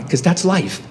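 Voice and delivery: ordinary talk, nothing marked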